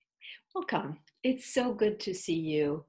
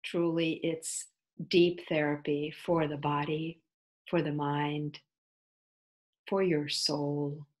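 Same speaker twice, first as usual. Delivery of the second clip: usual speaking voice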